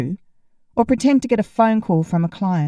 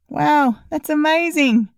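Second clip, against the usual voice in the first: high, fake cheerful wow; forced enthusiasm; 8 semitones higher, slower than usual. A narrator's reading. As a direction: force the excitement through a smile. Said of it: "I kept the smile going".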